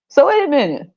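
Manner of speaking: ordinary delivery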